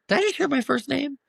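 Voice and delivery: high, put-on scandalized question; exaggerated teacher disbelief; mock shock. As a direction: act caught off guard, pitch jumping way up. Teacher mock-catches kids using first name.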